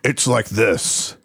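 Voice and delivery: low, slow demon voice. Shown as a demonstration. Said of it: "I like your demon voice".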